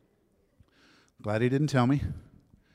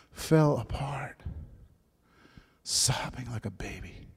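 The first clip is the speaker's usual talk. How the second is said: drawn out, then falling to a whisper; slow choked whisper; 4 dB quieter, slower than usual. Real, not acted. Preacher overcome retelling his conversion, slow and whispering. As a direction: stretch it slowly, moved to near tears. Emotional testimony of breaking down.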